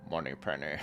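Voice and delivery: low Connery-style impression; Sean Connery. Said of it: "do your best Sean Connery".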